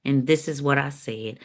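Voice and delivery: normal talking voice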